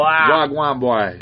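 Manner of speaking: slow Jamaican-accented WAGWAN; Jamaican greeting demo. Shown as a demonstration. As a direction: model the Jamaican accent slowly. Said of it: "But they say".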